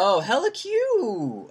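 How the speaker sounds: high, slow drawn hella cute; mock Bay slang. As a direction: stretch out hella cute teasing her. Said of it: "Oh, yeah, you did".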